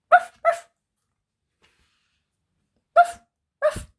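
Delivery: high, loud yappy puppy barks; happy puppy woofs. Acted. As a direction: bark like an excited little puppy. Puppy barking, story opening.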